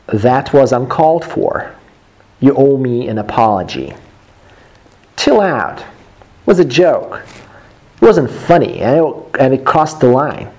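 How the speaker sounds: animated quarrel read for learners, wide pitch swings; expressive textbook dialogue with lively intonation; acted dialogue reading. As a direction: act out both sides of a tiff.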